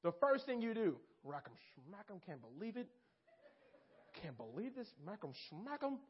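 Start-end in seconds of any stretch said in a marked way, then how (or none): 1.2-6.0 grumbling under breath. Retold or imitated. Grumbling after being overlooked.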